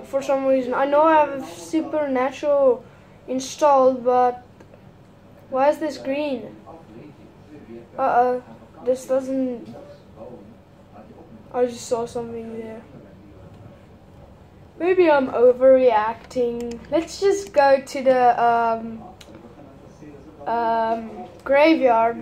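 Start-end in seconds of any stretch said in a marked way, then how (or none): none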